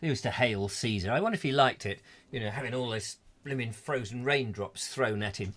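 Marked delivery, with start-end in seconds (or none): none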